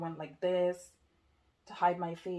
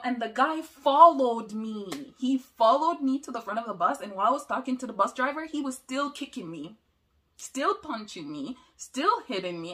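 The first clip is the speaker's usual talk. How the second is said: agitated retelling; 5 semitones higher, 11 dB louder than usual. Real, not acted. Reliving the attack, voice climbing.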